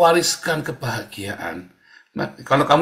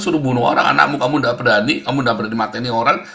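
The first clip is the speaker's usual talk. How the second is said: normal talking voice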